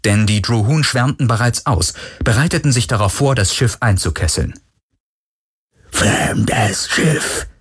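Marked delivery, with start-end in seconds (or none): 5.9-7.5 slow, booming machine announcement; robotic amplified command; electronically amplified voice. A narrator's reading. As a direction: boom it out like a menacing loudspeaker. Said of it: "dröhnte eine elektronisch verstärkte Stimme".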